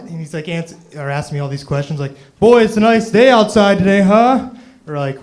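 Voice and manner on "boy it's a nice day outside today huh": loud, high, sing-song mock friendliness; chirpy greeter. Comic mimicking the bank greeter chatting him up, loud mock cheer. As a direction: ham up a pushy bank greeter.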